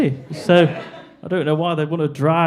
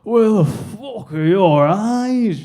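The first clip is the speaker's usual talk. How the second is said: put-on drunk bloke; 5 semitones higher, slower than usual. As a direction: stretch it out like a boozed-up heckler. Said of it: "this drunk bloke was like".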